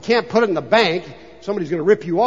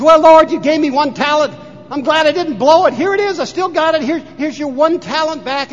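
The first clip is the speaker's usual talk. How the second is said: higher, louder, nervous servant grinning; 8 semitones higher, 6 dB louder than usual. Retold or imitated. Servant handing back his one talent to the master, nervous and grinning. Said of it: "nervous smile".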